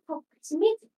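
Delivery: high, loud imitated gush; acted amazement. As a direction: exclaim it as the thrilled heroine. Retelling her amazed reaction.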